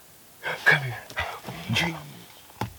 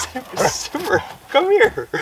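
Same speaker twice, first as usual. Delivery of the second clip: high coaxing call; 13 semitones higher, 6 dB louder, slower than usual. Real, not acted. Calling the overexcited dog.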